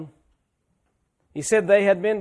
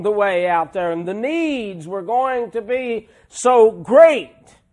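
louder, fervent pulpit preaching; preaching at full force; 3 semitones higher, 7 dB louder than usual. Acted. Preacher thrilled about God's closeness, preaching hard. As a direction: drive the words home from the pulpit.

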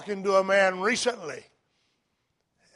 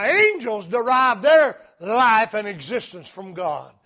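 ordinary delivery

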